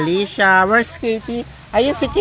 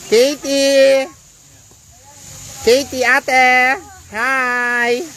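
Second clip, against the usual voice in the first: raised, drawn-out call; 4 semitones higher, much slower than usual. Real, not acted. Livestreamer getting swimming Katie's attention, calling out. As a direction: holler a greeting to someone in the distance.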